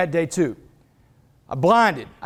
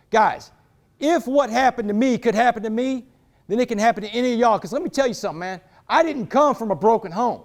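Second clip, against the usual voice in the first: ordinary delivery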